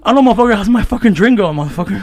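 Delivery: loud angry retort to the barista. Retold or imitated. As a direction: let the barista have it. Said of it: "I am pissed".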